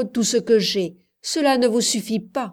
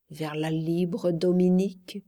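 ordinary delivery

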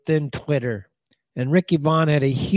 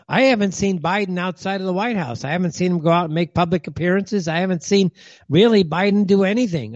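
ordinary delivery

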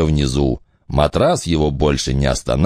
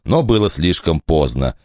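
ordinary delivery, nothing marked

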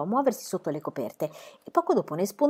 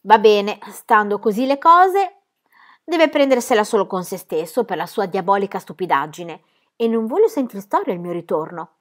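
firm loud final word; decisive; 7 dB louder than usual. Acted. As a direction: lay down the law to the hat.

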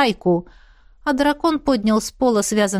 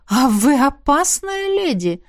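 slow, higher-pitched impressed exclamation; teasingly impressed; 5 semitones higher, slower than usual. A narrator's reading. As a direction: draw it out in amazed admiration. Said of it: "присвистнул Хуан".

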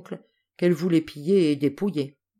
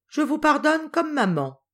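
majestic air; 9 semitones higher than usual. A narrator's reading. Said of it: "dit Paul d'un air majestueux".

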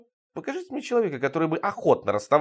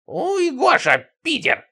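very high, louder squawky outcry; agitated cry; 13 semitones higher, 4 dB louder, much slower than usual. A narrator's reading. Сидорыч to Гоша after being insulted, agitated high cry. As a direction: shriek it in agitation, high and drawn out. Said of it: "взволнованно вскричал Сидорыч".

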